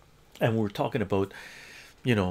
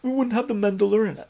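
mocking the customer; 10 semitones higher than usual. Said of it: "and he goes".

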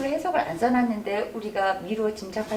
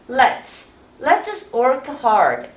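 slow model read; much slower than usual. Shown as a demonstration. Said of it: "구부리다가 뒤에 드 하면 돼요".

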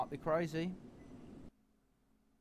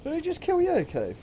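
surprised; 9 dB louder than usual. Real, not acted. Teammate killed by enemy.

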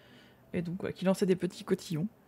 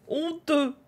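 playful scolding, higher; mock outrage; 6 semitones higher than usual. Streamer teasing the viewer who stole the answer, mock outrage. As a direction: tut at the thief in jest.

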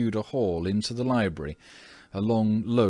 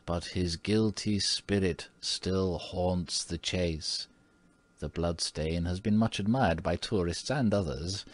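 low mysterious voice. A narrator's reading. Old housekeeper tells the family of the murder, low and mysterious. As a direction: lean in and make it sound haunted. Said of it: "in the same low, mysterious voice".

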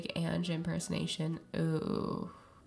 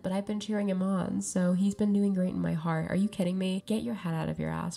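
ordinary talk

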